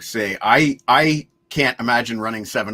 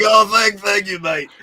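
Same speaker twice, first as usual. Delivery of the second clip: higher, louder imitation of a grateful caller; mock phone-call thanks, pitched up and loud; 7 semitones higher, 8 dB louder than usual. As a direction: do a loud, raised-pitch thank-you in his voice. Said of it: "that's [name] calling me. He's like".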